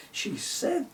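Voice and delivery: ordinary delivery, nothing marked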